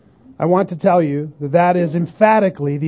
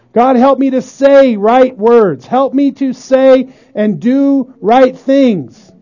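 earnest, pleading prayer spoken aloud, louder; model prayer; 4 semitones higher, 4 dB louder than usual. Pretend. Pastor models a repentant prayer for the congregation, pleading. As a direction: pray it out on your knees, begging forgiveness. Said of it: "You say".

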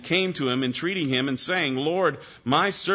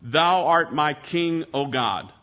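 slow, Thou art my king, art leaned on; declaration of faith; slower than usual. Shown as a demonstration. Preacher declaring the verse to his flock, slow and emphatic. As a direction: proclaim it slowly as a creed. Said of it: "what a fine declaration of faith".

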